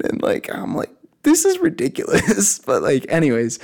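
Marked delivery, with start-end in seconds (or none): 1.2-2.6 retold disbelief. Retold disbelief at fit hikers.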